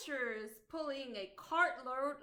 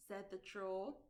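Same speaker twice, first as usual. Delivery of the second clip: ordinary delivery, nothing marked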